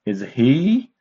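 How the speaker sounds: "Is he" with he rising high; "Is he" ending on a long upward glide. Shown as a demonstration. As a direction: swoop he up like a question. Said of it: "this is a rising tone".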